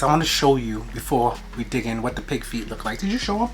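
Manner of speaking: normal talking voice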